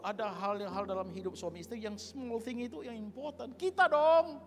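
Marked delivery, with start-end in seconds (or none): 3.6-4.4 loud, high exclamation; high, punched-out pulpit cry; emphatic shout. Real, not acted. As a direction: shout it out at the congregation. Preacher shouts emphatic answer.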